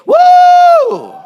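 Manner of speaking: high loud party whoop. Acted. Party guests cheering, high loud whoop. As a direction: let out a big celebratory whoop.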